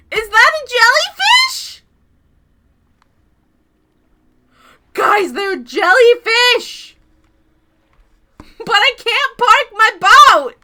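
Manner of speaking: loud, high exclamation; excited shout. Real, not acted. Streamer yelling to chat about jellyfish, loud and high. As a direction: yell it at the chat.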